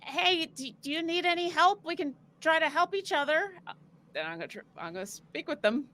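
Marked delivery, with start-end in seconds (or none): 0.0-3.5 higher-pitched friendly call to rats. Acted. Player's character calls out to live rats, calling out. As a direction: call out to them, voice lifted. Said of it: "gonna call out to them".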